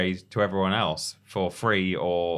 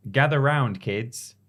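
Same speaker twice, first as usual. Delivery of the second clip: storyteller voice; 5 semitones higher, 3 dB louder, slower than usual. Acted.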